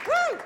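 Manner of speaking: loud whooping cheer; high excited whoop. Real, not acted. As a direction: whoop to pump up the crowd. Host cheers to the crowd.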